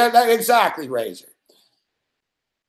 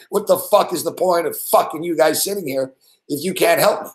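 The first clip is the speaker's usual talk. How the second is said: usual speaking voice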